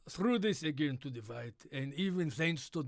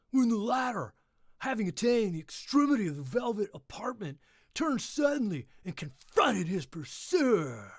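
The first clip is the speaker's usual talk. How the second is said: loud, higher climax, slowing at the end; dramatic climax; 6 semitones higher, 6 dB louder than usual. Acted. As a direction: hit the climax loud and big. Climax read louder.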